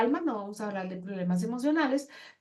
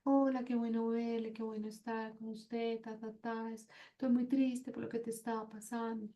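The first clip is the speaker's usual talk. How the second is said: much softer, sunken, downcast hello; too gloomy greeting; 11 dB quieter than usual. Shown as a demonstration. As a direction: let the voice sink into sadness. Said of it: "pero tampoco estar". (saludo apagado y triste, mucho más suave)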